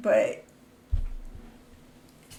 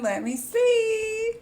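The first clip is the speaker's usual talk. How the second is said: excited squeak; 12 semitones higher, slower than usual. Real, not acted. Spots the game, gets excited.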